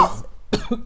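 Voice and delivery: rough choking cough. Real, not acted. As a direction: cough as if something went down wrong. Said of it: "Excuse me, I'm choking".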